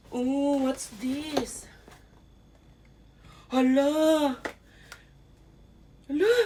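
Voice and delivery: loud, drawn-out gasp of amazement; real surprise. Real, not acted. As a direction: exclaim at an unexpected gift. Finds unexpected watch in package. (gulat na gulat)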